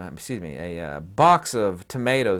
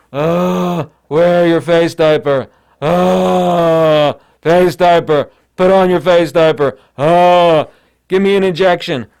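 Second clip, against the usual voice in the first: loud, higher-pitched zombie drone; mock zombie; 5 semitones higher, 11 dB louder, slower than usual. Mocking the world as face-diaper-wearing zombies, in a loud zombie drone. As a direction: moan it out like a brainless zombie. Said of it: "seems like this. It seems like some sort of".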